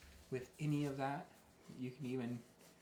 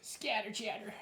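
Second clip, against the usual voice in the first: infomercial voice; 8 semitones higher than usual. Pretend. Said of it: "Sounds like an infomercial".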